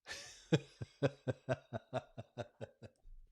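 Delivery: long burst of real laughter; laughing. Real, not acted. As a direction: crack up in a string of chuckles. Cracks up at own innuendo.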